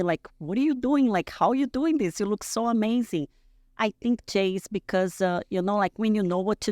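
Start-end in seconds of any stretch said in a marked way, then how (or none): 0.4-3.3 higher-pitched voice of curious fans; imitated admiring questions. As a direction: imitate admirers asking how she does it. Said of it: "all the women reach out to me".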